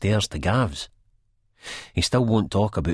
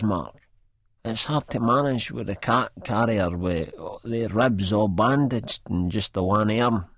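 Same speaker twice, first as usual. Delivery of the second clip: Spud voice; 5 semitones higher than usual. A narrator's reading. Spud voiced, sad request.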